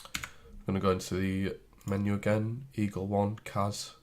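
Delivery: normal talking voice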